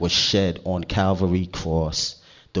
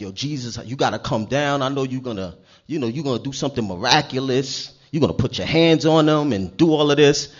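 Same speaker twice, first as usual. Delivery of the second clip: higher-pitched, insistent mock begging; imitated pleading; 6 semitones higher, 6 dB louder than usual. Nobleman begs Jesus to come down, imitated pleading. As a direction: act out an eager, pressing plea. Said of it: "He came crying, begging".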